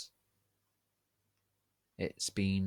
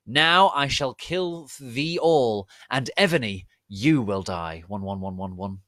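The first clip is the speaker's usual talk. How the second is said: hammy villain; 3 semitones higher, 8 dB louder than usual. Acted. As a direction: boom it out like a cartoon dark lord. Villain threatens again.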